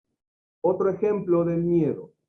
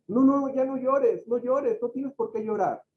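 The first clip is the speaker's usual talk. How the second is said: mock consoling; 6 semitones higher than usual. To a crying person, a higher mock hush of comfort. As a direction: imitate someone shushing a crier.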